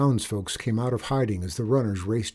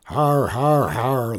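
mock gang laughter; 5 dB louder, slower than usual. A narrator's reading. Gang laughing at the boss's threat, jeering. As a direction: laugh along like a gang of outlaws. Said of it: "laughed the gang".

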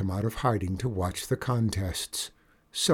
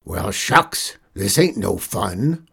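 complaining; 2 semitones lower, 5 dB louder, slower than usual. A narrator's reading. Gang boss griping that there is no fun, complaining. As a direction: grumble like a put-out outlaw. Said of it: "Hogbone complained".

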